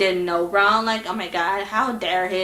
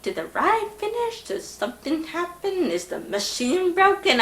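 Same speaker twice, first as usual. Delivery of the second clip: hushed worried questions; 6 semitones higher, 8 dB quieter than usual. Retold or imitated. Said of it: "I was like".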